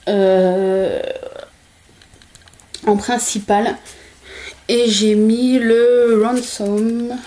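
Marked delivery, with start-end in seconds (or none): 4.7-7.2 drawn-out, slow mock English accent on Ransom. Naming her eyeshadow with a joking English accent. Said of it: "avec mon super accent de ouf".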